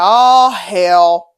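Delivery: loud, drawn-out exclamation; dad's dismayed cry. Retold or imitated. Dad seeing his kid's bloody nose, loud dismay. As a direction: let it burst out long and loud.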